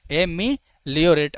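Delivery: "एमिलियोरेट" broken into ए-मि-लियोरेट; syllable-by-syllable demo. Shown as a demonstration. Teacher showing students how to say it, "एमिलियोरेट" split into syllables. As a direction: sound out "एमिलियोरेट" in separate chunks. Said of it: "ऐसे बोलो".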